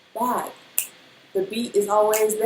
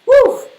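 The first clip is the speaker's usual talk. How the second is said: loud whew; 10 dB louder than usual. Real, not acted.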